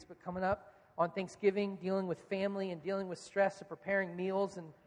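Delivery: low, earnest prayer for the room; leading the church in prayer; pastoral prayer. Acted. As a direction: pray over them gently. Leads congregation in prayer.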